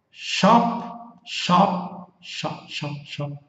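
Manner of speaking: Shop drawn out as a long unvoiced sh; isolated sh sound. Shown as a demonstration. Teacher drilling the sh of Shop for students, long hissed sh. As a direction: hiss out the sh of Shop alone. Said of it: "Shop assistant".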